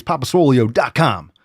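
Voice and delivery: ordinary talk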